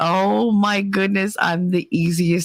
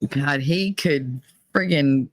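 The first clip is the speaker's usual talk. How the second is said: usual speaking voice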